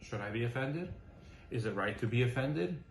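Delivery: hushed, reflective doubt; soft self-questioning. Shown as a demonstration. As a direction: ask yourself gently and honestly. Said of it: "Question mark".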